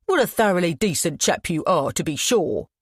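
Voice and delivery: lightly put-on posh English accent, mocking; a hint of upper-class English, sneering. Todd mocking Ian in a light upper-class English accent. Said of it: "mimicking an upper-class accent".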